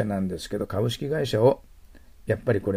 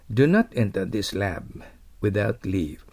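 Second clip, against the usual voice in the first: slow model reading; much slower than usual. Shown as a demonstration. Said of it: "teacher reads example slowly".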